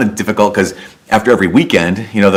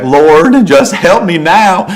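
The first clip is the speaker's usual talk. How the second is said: much higher, louder, slow pleading Lord help me; desperate prayer; 9 semitones higher, 5 dB louder, much slower than usual. Retold or imitated. Joe praying for help before answering, desperate and slow. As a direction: beg God for rescue, drawn out.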